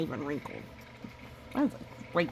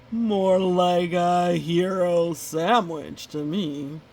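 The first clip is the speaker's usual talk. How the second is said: slow, louder wolf gloat; 5 dB louder, slower than usual. Acted. Wolf gloating over Goose as his next meal, slow and loud. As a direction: savor the joke about eating him.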